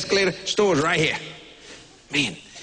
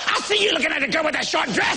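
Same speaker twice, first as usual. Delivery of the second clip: higher, shouted accusation; jealous wife accusing; 7 semitones higher than usual. Retold or imitated. Wife names the girl in the short dress, shouting. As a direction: point the finger hard. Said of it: "You see me looking".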